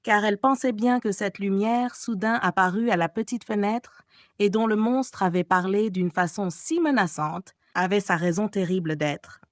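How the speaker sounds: usual speaking voice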